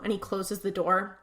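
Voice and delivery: ordinary talk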